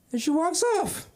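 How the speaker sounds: ordinary delivery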